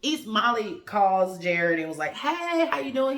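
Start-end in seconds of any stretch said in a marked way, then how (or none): 2.1-3.2 cheery greeting. Retold or imitated. Molly's cheery call to Jared.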